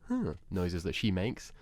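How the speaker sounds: normal talking voice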